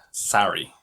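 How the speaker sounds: sorry said the American way, "sar-ee". Shown as a demonstration. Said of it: "more like".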